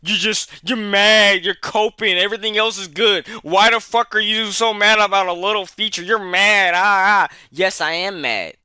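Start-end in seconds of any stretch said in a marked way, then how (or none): 0.0-7.4 whiny mock critic, higher and louder. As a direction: sneer it like a smug commenter. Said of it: "some people might be like".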